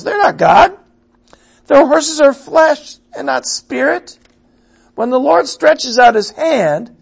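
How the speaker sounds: loud, rising preaching, peaking high; forceful scripture reading. Acted. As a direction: proclaim the prophecy with mounting fire. Reads scripture at full force.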